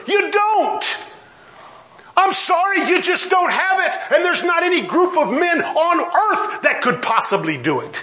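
high, emphatic pulpit rebuke; impassioned preaching. Real, not acted. Preacher telling people they cannot fix the world, impassioned. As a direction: drive each phrase home from high in the voice.

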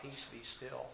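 a hushed, near-silent "still" instead of a holler; calm the storm with a quiet, breathy command. Retold or imitated. Lord commanding the storm, hushed and soft. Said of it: "and he hollers out".

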